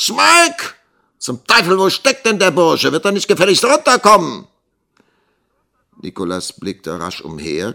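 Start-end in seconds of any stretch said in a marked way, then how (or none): 0.1-4.5 high, loud, impatient tirade; angry shouting. A narrator's reading. Schoolmaster calling for a missing servant boy, angry shouting. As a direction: yell for him, then rush the demand.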